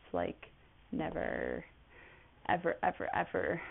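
low, hushed stretched never ever ever; deadpan insistence. Real, not acted. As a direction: drag out each ever for emphasis. Insisting she never wants kids.

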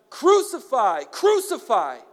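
loud, high, falling shout; the mob demanding death; crowd shouting. A narrator's reading. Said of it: "they shouted".

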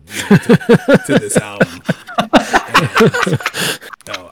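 words squeezed out through high loud laughter; laughing. Real, not acted. Correcting himself mid-joke, laughing hard and high. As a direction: try to finish the line while cracking up.

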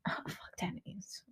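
hushed scornful whisper. Real, not acted. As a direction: whisper it with disgust. Whispered dismissal.

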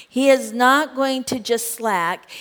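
usual speaking voice

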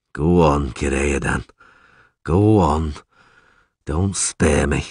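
low, slow, weary urging; heavy, low resignation; resigned dread. Acted. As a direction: brace for bad news and drag it out. Dreading the rest, asks for it.

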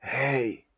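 "Hey" soft and low on an outgoing breath. Shown as a demonstration. Teacher showing how Hey is pronounced, breathing out "Hey". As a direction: let "Hey" out as a quiet exhale. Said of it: "by simply breathing out".

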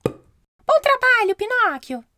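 high, slow chirpy cricket praise; squeaky little cricket voice; voz de grilo. Acted. Grilo elogia Pinóquio. (voz aguda e lenta de grilo)

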